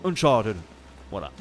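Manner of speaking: Uncharted in a clumsy put-on English accent; rotten English accent. Real, not acted. French player naming the game, in a rotten English accent. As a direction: overdo the English accent, badly. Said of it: "je prends cet accent pourri".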